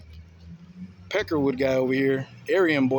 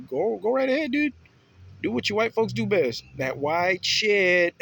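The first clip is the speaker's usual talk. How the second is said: loud, higher taunting with slow last words; defiant taunt; 6 semitones higher, 5 dB louder than usual. Retold or imitated.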